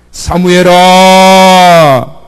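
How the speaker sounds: slow, loud, long-held summons; divine call. Acted. God calls young Samuel in the night, slow and loud. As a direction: let the name hang like a voice from heaven.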